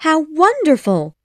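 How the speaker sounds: high, loud delight; excited exclamation. A narrator's reading. Wendy hears of tree houses, high excited exclamation. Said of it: "Wendy exclaimed".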